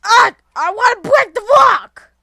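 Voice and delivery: high, very loud yell; frustrated shout. Real, not acted. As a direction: scream your frustration at the game.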